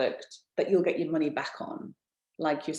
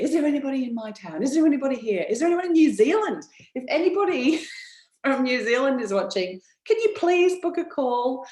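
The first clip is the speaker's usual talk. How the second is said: high, fast and louder, asking urgently; eager customer voice; 8 semitones higher, 6 dB louder than usual. Retold or imitated. Customers emailing the speaker, begging for a seller in their town, in a high eager voice. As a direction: voice the customers pleading for a local seller.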